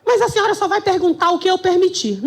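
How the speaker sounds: high-pitched mock shout; judge yelling. Judge to defiant lawyer, mock yelling. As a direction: imitate a judge laying down limits. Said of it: "E ele gritando".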